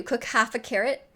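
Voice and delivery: ordinary talk, nothing marked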